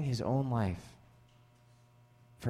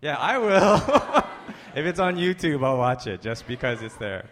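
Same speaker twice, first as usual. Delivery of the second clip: laughing admission; 6 dB louder than usual. Real, not acted. Laughs admitting he'd watch.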